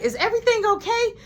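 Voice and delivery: very high, concerned question; high-pitched boss checking on staff; checking-in supervisor. Retold or imitated. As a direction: pipe up over a squabble. Supervisor interrupts an argument.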